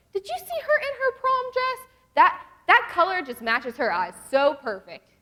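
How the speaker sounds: high, loud mock gushing; hammed-up girlish admiration; gushing envy. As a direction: gossip in exaggerated awe over another girl. Mimicking girls envying another girl.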